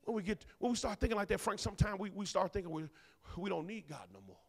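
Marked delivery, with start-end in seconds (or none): none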